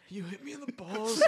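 high, strained laughing whine; mock pained laughing; laughing complaint. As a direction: wheeze it out bent over. Wounded friend complaining.

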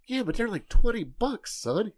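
high mock protest about price; exasperated dad. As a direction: push back in disbelief.

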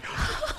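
sharp acted gasp; reenacted gasp of overwhelm. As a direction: gasp as if suddenly overcome. Acting out being overwhelmed.

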